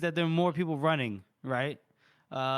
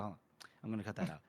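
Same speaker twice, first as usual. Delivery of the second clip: ordinary talk, nothing marked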